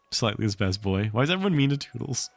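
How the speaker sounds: ordinary delivery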